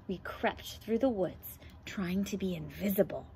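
hushed whisper, creeping; sneaky whisper. Acted. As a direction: whisper like hiding in the woods. Creeping through woods invisibly.

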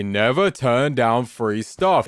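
slow, drawn-out motto voice, every word spaced. Shown as a demonstration. Sharing a life rule with viewers, slow and deliberate. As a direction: lay down the rule slowly to the viewers. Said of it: "And do you know what I say?".

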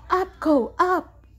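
short, urgent cries; crying out. A narrator's reading. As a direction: command the animal to rise. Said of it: "Grand Dude cried".

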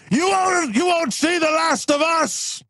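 high, drawn-out cartoon yell; hammed-up vengeful cry; villain's parting threat. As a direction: shout it after the fleeing ship, slowing at the end. Villain yells after departing ship.

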